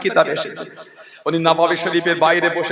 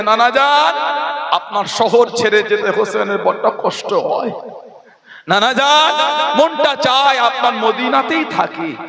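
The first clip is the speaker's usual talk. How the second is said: tearful plea; 6 semitones higher than usual. Acted. Husain at his grandfather's tomb, acted tearful plea. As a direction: plead as if weeping, stretching the address. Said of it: "নবী রওদার কাছে দাঁড়িয়ে কাঁদছে".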